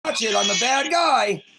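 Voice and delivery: high, loud, hammed-up sarcastic shouting; mock anger. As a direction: ham up fake rage to mock the officers. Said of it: "Look at how mad and angry I am".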